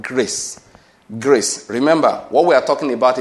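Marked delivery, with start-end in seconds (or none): 0.0-1.7 "grace" set apart by pauses and repeated; emphasis on grace. Real, not acted. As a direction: let "grace" stand alone, each time with a falling tone. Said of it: "lay emphasis on that word".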